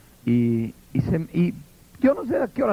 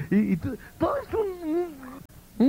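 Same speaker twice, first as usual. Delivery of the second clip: mock weeping; 10 semitones higher, 4 dB quieter, slower than usual. Mocking emotional crying worship.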